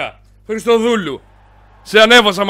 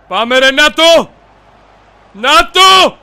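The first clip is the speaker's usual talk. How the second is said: drawn-out loud cry, rising higher; excited shout; 8 semitones higher, 6 dB louder, much slower than usual. Real, not acted. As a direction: build to a goal yell. Rising excitement as goal comes. (ενθουσιασμένη κραυγή)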